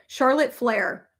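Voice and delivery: slow, deliberate name for a voice search; slow for the assistant. Real, not acted. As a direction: spell it out slowly for the phone. Speaking to voice assistant.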